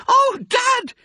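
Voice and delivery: very high, loud cub squeal; excited cry. A narrator's reading. Smallest fox calls Dad, thrilled cry. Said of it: "he cried out".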